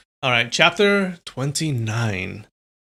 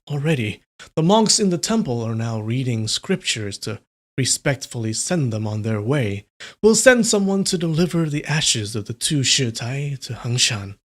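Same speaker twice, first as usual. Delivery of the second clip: louder, steady read-aloud narration; story narration; 2 semitones higher, 5 dB louder than usual. A narrator's reading. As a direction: read the chapter out to chat like a storyteller. Reads novel chapter aloud.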